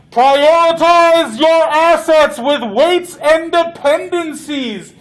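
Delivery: loud, high, slow threatening yell; alien yelling. Acted. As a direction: bellow the threat like an alien commander. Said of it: "it starts yelling".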